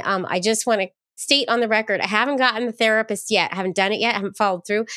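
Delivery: ordinary talk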